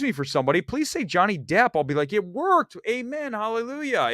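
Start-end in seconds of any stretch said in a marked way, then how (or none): 2.1-4.1 high-pitched hallelujah of relief; mock rejoicing. Rejoicing at being mistaken for Johnny Depp, mock-jubilant. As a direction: celebrate as if a prayer were answered.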